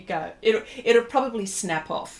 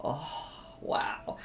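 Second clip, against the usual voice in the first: mock hushed wow; 6 semitones lower, 10 dB quieter than usual. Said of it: "And I'm going".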